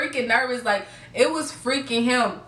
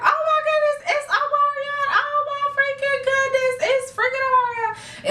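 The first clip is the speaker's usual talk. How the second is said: fangirl squeal. Retold or imitated. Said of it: "Everyone's like".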